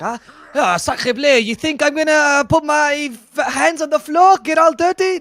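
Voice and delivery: high, loud, hammed-up mock French accent; hammed-up French Pango accent. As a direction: play a snooty French gentleman who won't get his hands dirty, way up high. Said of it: "it's a pretty good impression".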